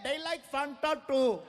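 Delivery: put-on accented crowd calling out; helpful crowd shout. Indian crowd calling out to help the comedian, accented. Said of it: "They're yelling".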